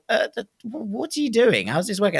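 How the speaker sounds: high, bewildered stammer; stammering in disbelief; baffled. Retold or imitated. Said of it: "and then you're going".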